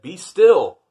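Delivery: loud, high shout of Be still; a child king's yelled order; yelled command. Acted. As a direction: bellow it at the monsters. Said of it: "That means he yelled it".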